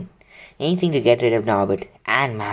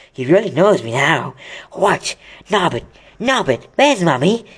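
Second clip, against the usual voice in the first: cooing Hagrid; 4 semitones higher, 4 dB louder than usual. Acted.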